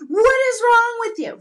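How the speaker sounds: high, loud panicked yell, repeated; re-living the panic from the bathtub, shouting; retold scream. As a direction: shriek it at the husband holding the snake. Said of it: "I started screaming, going".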